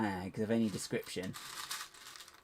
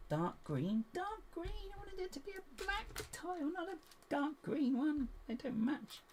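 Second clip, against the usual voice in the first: mock whiny complaint; slower than usual. Builder finding dark green tiles, high mock whine.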